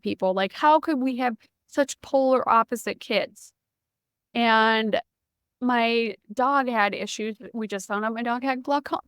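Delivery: ordinary delivery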